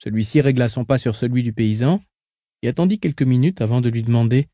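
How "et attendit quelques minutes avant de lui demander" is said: ordinary delivery, nothing marked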